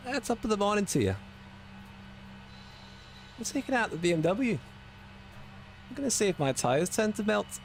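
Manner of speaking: high, lilting mock Irish accent; put-on Irish accent. Shown as a demonstration. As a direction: do a hammed-up Irish accent, pitched well above your own. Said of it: "You Irish?".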